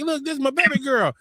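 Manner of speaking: high, beaming proud father; proud new dad. Retold or imitated. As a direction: show the baby off, lifted up high. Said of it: "I'm so happy".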